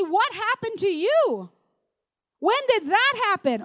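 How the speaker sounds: high, swooping questions; amazed questioning. Acted. People seeing someone's sudden breakthrough, amazed questioning. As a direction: act the astonished onlooker.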